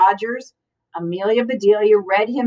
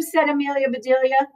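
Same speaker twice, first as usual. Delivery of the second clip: plain reading voice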